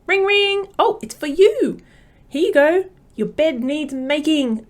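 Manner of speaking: high, bright mock phone ringer then a slow announcement; playful pretend phone call. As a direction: play a silly phone operator for the kids. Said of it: "pretend to ring an imaginary phone in their pocket".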